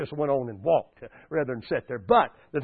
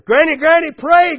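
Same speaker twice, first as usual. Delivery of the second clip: high, loud calling out; urgent plea; 9 semitones higher, 9 dB louder than usual. Retold or imitated. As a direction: cry out to granny for help. Neighbour begging granny as tornado comes.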